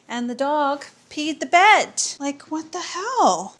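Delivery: dog peed on our bed, what the hell; outraged disbelief. Real, not acted.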